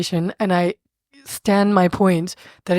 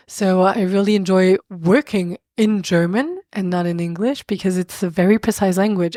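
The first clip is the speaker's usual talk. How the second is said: normal talking voice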